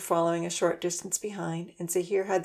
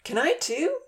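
begging; 10 semitones higher, slower than usual. A narrator's reading. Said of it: "Zahir begged".

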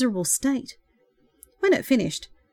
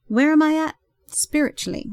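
little laugh; 7 semitones higher than usual. Acted. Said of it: "he echoed with a little laugh".

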